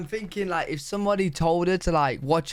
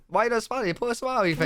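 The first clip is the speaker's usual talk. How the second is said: mock nosy stranger, pitched up; imitating a pushy local; 6 semitones higher, much faster than usual. Southern stranger scolding a grumpy visitor, mock pushy. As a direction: play a local scolding someone for not smiling. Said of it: "be like".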